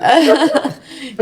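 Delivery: short real laugh. Real, not acted. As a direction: laugh along at the emotional moment. Host's remark about crying.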